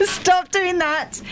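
high drawn-out stop; high, slow protest. Real, not acted. Laughing protest at teasing.

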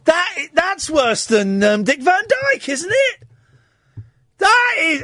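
high, amazed disbelief at the accent; incredulous. Real, not acted. Host reacting to the awful Cockney in disbelief. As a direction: react as if it can't be real.

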